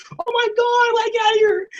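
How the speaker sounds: very high, squealing panic; mock panic. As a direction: squeal it like a girl fleeing town. Voicing heroine fleeing in panic.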